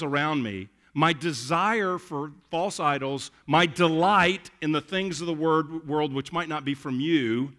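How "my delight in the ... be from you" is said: ordinary delivery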